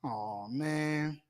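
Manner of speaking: ordinary delivery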